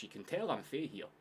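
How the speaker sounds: ordinary delivery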